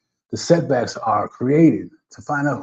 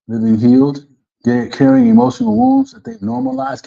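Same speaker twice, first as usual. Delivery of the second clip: ordinary talk, nothing marked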